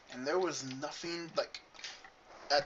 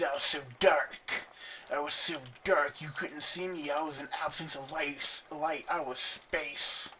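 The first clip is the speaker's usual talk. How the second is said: mock dramatic; 5 dB louder than usual. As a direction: overblown brooding goth boast. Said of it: "That sounded probably really nerdy".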